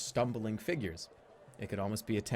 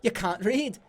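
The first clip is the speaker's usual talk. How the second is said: higher, louder cutesy voice; not a goblin; 11 semitones higher, 7 dB louder than usual. Acted. Half-goblin talking down to the player, higher and louder. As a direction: try a goblin, miss it. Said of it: "that's not a goblin".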